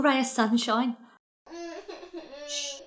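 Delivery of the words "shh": hushing shh. Acted. Hushing herself after grief.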